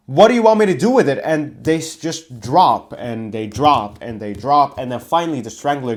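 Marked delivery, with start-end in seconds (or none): 0.1-1.2 higher, louder and fast, a baffled referee; confused in-character plea. Retold or imitated. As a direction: blurt it out, bewildered, to the wrestlers. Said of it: "I tried to ask them in character".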